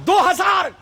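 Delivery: high, incredulous echo; acted astonishment. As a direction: throw the figure back in disbelief. Saint astonished at low price.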